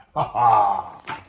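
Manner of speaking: loud wicked witch laugh; a villain's gloating laugh; evil cackle. Acted.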